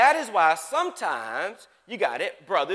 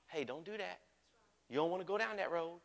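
soft, much quieter, kindly caution; gentle quiet warning; 3 semitones lower, 13 dB quieter than usual. Retold or imitated. Holy Spirit gently warning the preacher, soft and kind. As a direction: warn them softly, like a patient friend. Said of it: "he ain't yelling at me".

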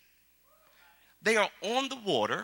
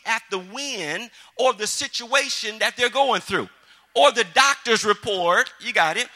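building preaching voice; 3 semitones higher, 5 dB louder than usual. Acted. Building sermon back to full force.

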